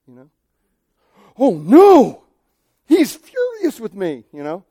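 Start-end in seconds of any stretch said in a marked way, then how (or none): 1.4-4.3 mock panic. Panicked reaction to caps text.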